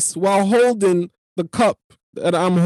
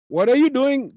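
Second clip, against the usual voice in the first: higher-pitched imitation Indian accent; Rajesh's Indian accent; 8 semitones higher, 4 dB louder than usual. Imitating a man he knows, high mock Indian accent. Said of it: "Rajesh really sound like that".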